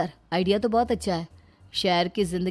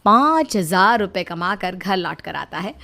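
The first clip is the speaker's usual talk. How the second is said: brisk, louder storyteller narration; story narration; 5 dB louder than usual. A narrator's reading. Narrator telling viewers what Vivek earned, loud and clear. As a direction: tell the twist loudly and cleanly.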